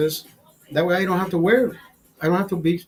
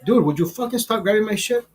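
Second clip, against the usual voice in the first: harsh scolding; 6 semitones higher than usual. Pretend. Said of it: "rather than me saying".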